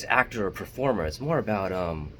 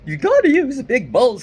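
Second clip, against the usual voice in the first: much higher and much louder hammed-up macho guy; big balls guy voice; 13 semitones higher, 9 dB louder than usual. Shown as a demonstration. Vlogger demos the macho voice his friend disliked, loud and high. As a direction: blast it out like a pumped-up tough guy. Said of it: "kind of voice".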